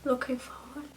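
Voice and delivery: unsure, whispered under her breath; whispered aside. Real, not acted. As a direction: whisper it to yourself, doubting the phrase.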